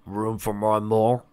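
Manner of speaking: slow, lightly voiced father; welcoming father. Father offering his last free hoof, welcoming. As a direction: offer it slowly as a playful dad.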